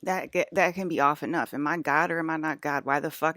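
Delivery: normal talking voice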